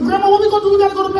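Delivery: put-on high pleading child; a kid begging up high; whiny kid voice. Child asking grandma about water, whiny and high.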